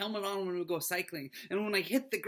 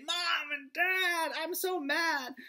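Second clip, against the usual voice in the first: put-on high, slow childish complaint with a pout; whiny angry kid; 11 semitones higher, 3 dB louder, slower than usual. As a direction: play a little kid whining to his parents. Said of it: "I want to use my words and say".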